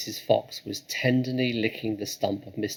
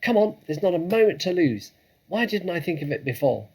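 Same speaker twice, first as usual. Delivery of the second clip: much higher, louder eureka; excited shout; 8 semitones higher, 6 dB louder, faster than usual. Acted. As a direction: burst out with the idea.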